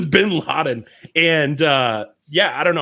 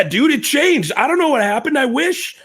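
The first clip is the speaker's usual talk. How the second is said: incredulous exclaiming; 12 semitones higher, 3 dB louder than usual. Real, not acted. Exclaiming about changed high school.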